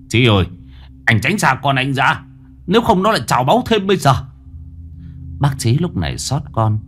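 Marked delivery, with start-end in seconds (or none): 0.1-4.1 fast, rising urgent warning; hurried warning. Acted. Old man hurriedly warning the father away. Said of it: "Ông Đẩu vội vàng".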